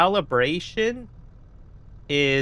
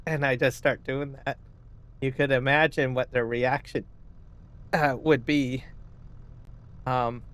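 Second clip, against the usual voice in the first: ordinary talk, nothing marked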